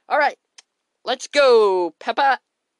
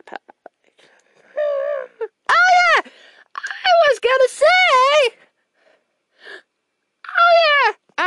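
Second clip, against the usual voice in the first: high excited cry; excited shout; 6 dB louder, much slower than usual. Pretend. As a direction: squeal it out, thrilled. Character excitedly remembers.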